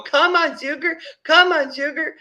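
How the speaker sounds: high, loud sing-out of encouragement; coaxing call. Retold or imitated. Staff urging her to come along, high coaxing call.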